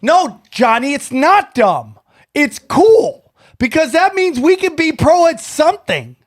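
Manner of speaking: high, insistent protest; emphatic enthusiasm. Real, not acted. Host telling Johnny pro tag is cool, emphatically enthusiastic. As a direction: argue it up with rising excitement.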